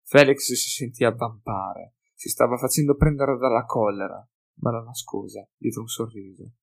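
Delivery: ordinary delivery, nothing marked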